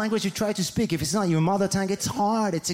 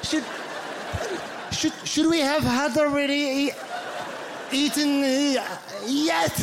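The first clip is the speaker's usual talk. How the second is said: high, halting, stammered question; confused grammar struggle; 7 semitones higher than usual. Acted. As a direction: grope upward through the grammar, restarting words. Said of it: "It's confusing. The grammar for us".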